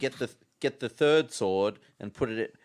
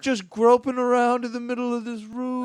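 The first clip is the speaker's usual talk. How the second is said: much higher, friendly, sing-song ghost; bright welcoming voice, pitched up high; cheery ghost voice; 12 semitones higher, 10 dB louder than usual. Acted. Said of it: "trying out a couple of different voices".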